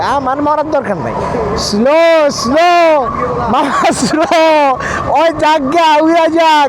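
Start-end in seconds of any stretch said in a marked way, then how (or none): 1.6-6.7 loud, high call to slow down; urgent shout. Real, not acted. Passenger shouting at the rider to go slow, loud and urgent. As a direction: yell it over the engine to the driver.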